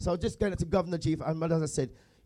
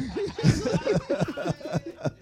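real laugh; 11 semitones higher than usual. Real, not acted. Host laughing at banter during his speech, real laugh. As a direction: laugh helplessly at the joke.

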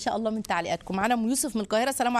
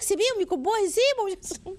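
imitating an indulgent grandmother; 8 semitones higher than usual. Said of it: "تقول لك".

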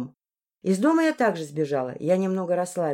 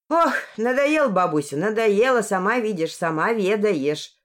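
usual speaking voice

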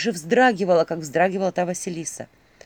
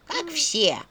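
голос черепа; 6 semitones higher than usual. A narrator's reading. Talking skull answers.

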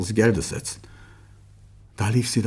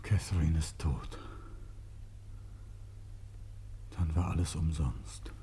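low, much softer, numb; stunned grief; 6 semitones lower, 13 dB quieter than usual. Acted. A man told his wife has died, numb and quiet with grief. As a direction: let the news sink in quietly.

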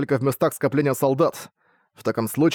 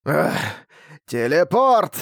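monster cry; 3 semitones higher, much slower than usual. Acted. Monster casting.

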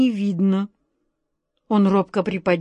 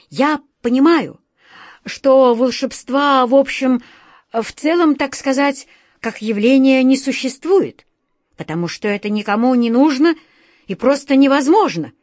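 higher, louder, halting words; faltering explanation; 5 semitones higher, 5 dB louder than usual. A narrator's reading. The director faltering as he explains to Alyosha. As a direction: stumble through the argument. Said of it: "сбивчиво пробовал объяснить".

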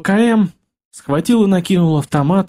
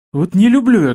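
plain reading voice

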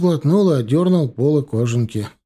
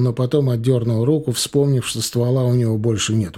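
ordinary delivery

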